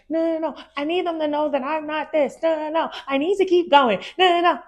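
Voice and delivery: high, frantic mock protest; her stubborn past self. Imitating her past self refusing to rest, high and frantic. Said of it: "And I was like".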